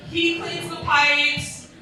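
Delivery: slow, deliberate deadpan; deadpan punchline. Real, not acted. To the crowd, slow deadpan punchline. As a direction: let each word land slowly. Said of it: "punchline delivery".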